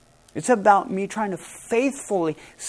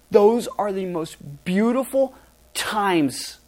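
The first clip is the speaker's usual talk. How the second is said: ordinary delivery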